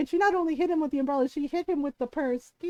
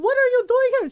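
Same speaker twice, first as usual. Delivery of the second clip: high, loud retold outburst; scolding; 8 semitones higher, 5 dB louder, faster than usual. Mom scolding the nephew she just hit, exasperated.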